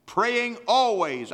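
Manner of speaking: slow, high and loud; proclaiming slowly to the church; slow proclaimed phrase. Real, not acted. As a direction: draw out each word. Preacher stretching scripture phrase.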